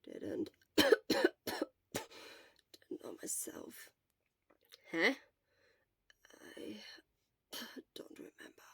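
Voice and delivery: soft, hoarse whisper. Acted. Exhausted Shota answering his friend in a hoarse whisper. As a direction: scrape the word out weakly. Hoarse weak patient with coughs.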